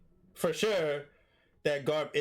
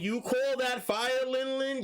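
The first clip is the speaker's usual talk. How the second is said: mock taunt; 8 semitones higher than usual. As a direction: stretch out the taunt, pitched up. Acted taunt of Garp to Big Mom.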